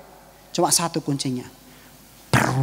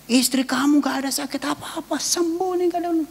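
baffled professor; 11 semitones higher than usual. Retold or imitated. Professor telling husband his wife is healed, high and baffled. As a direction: imitate a bewildered professor, voice climbing high. Retold professor announces puzzling recovery.

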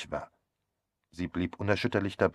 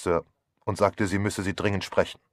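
ordinary delivery, nothing marked

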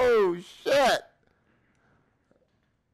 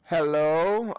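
ordinary talk, nothing marked

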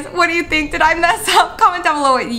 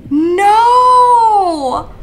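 loud, high cry of no; frustrated shout; 6 semitones higher, 4 dB louder than usual. Real, not acted. To her viewers as the trade slips away, shouted no. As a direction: yell it out in dismay.

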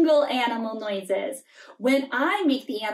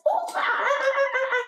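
monkey laugh; 12 semitones higher than usual. Pretend. Voicing a noisy monkey.

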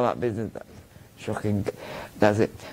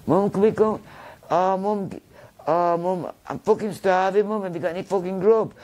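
mimicking a kid; 8 semitones higher than usual. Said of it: "he used to be able to swear to me".

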